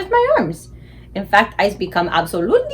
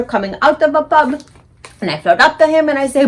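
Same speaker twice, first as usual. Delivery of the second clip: usual speaking voice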